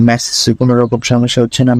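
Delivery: normal talking voice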